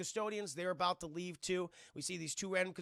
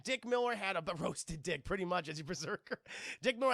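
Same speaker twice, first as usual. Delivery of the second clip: ordinary talk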